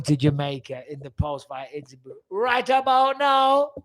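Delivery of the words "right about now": high, loud, slow Jamaican catchphrase; Jamaican accent. Acted. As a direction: draw it out in a put-on Jamaican accent. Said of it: "use the Jamaican accent".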